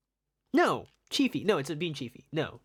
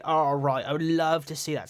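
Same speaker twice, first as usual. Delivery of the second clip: ordinary delivery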